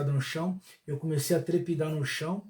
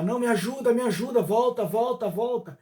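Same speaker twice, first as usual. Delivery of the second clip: higher pleading cry, somewhat raised; retold cry for help; 6 semitones higher than usual. Lost soul crying out to the narrator, pleading higher. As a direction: beg them to come back, higher and urgent. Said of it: "começava a gritar".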